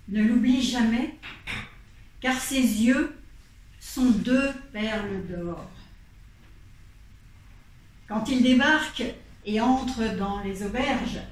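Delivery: usual speaking voice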